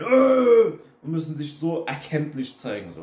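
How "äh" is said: loud, high mocking shout; mock angry yell. Imitating loud people who scream when things go wrong, a loud mock yell. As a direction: blast out a short angry yell. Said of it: "da müssen die direkt schreien".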